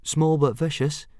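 high-voiced boy chipping in; high, eager little addition; helpful. A narrator's reading. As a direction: pipe up helpfully. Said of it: "added Fishlegs helpfully".

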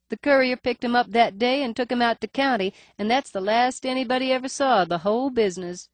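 high nasal voice, faint lisp. A narrator's reading. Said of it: "Her voice is higher ... nasal cast".

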